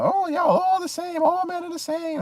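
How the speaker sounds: parodying women who say all men are the same; mocking women's voice. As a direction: squeak it out like a scornful woman. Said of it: "that was a big narrative too growing up".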